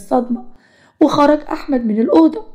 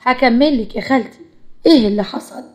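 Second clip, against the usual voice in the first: ordinary delivery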